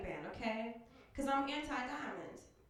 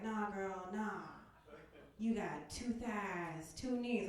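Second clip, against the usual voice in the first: lower, quiet, lazy drawl of a street guy; homeless guy voice; 5 semitones lower, 6 dB quieter, much slower than usual. Retold or imitated. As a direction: sweet-talk her in a low voice. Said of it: "He's like".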